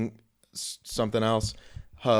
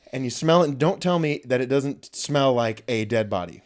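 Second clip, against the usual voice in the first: ordinary talk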